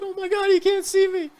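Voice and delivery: very high, squeaky mock voice; goofy excited friend. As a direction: play the giddy kid sure he's invisible. Imitating invisible-test friend.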